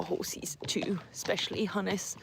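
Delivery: whispered secret. Real, not acted. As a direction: confide it secretly. Secret confidence to viewers.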